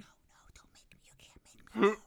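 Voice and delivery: tiny unwilling whisper, retold; whispered pleading. Shy child asked to talk to aunt, pleading whisper. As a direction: whisper the refusal as you shrink away. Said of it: "I'll melt, and I'll just be like".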